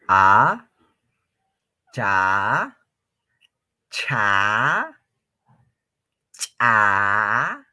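"cha" said slowly and clearly, syllable by syllable; slow teaching syllables. Shown as a demonstration. Teacher models the alphabet for the students, slow and clear. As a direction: drill "A, cha, cha, cha" with a pause before each syllable. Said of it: "repite la pronunciación".

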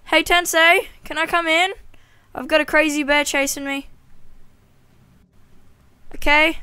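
high loud panicked calling; being chased. Real, not acted. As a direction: shout for help while fleeing.

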